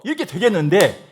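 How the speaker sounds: ordinary talk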